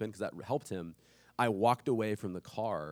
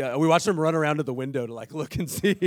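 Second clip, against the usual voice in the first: ordinary talk, nothing marked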